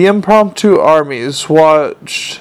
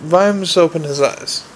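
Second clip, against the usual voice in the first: ordinary delivery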